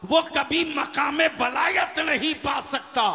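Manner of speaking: loud, ringing sermon oratory; full-force preaching. Real, not acted. Preacher telling the crowd who never earns sainthood, full-force preaching. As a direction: hurl each line at the congregation.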